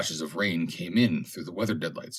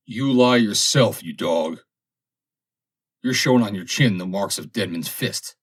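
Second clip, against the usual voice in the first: angry accusation; 3 semitones higher, 6 dB louder than usual. A narrator's reading. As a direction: hurl it at him like a charge. Sailors quarrelling, angry accusation.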